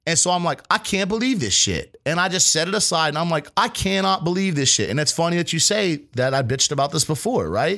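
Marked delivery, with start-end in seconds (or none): 0.7-2.0 retold exasperation, pitched up; exasperated disbelief. Cook muttering over botched plastic wrap, exasperated disbelief. 3.6-4.9 retold exasperation, higher, leaning on "cannot"; emphatic disbelief. Cook repeating himself over botched plastic wrap, emphatic disbelief.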